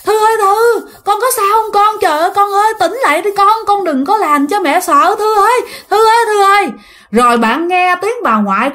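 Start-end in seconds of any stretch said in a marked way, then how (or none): 0.0-6.7 mother crying out. Retold or imitated. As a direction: call your child's name in panic. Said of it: "tiếng mẹ của bạn la khóc". (mẹ gào khóc gọi con, giọng cao hoảng hốt)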